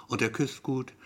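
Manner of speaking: ordinary delivery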